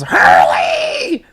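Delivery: high squawked bird call; imitated bird shrieking a name; jungle bird cry. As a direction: screech the name like a bird flying out. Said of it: "this bird that flies out in the jungle that goes".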